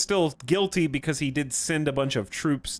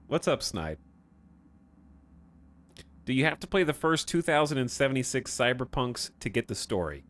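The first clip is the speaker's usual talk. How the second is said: usual speaking voice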